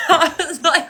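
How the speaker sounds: high laughing aside. Real, not acted. Streamer laughing while retelling, high. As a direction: laugh through the words.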